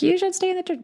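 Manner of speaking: high, squeaky mock concern; mocking, high-pitched imploring; pleading church member. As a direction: mimic a meddling member in a high voice. Mimicking church members who might approach her.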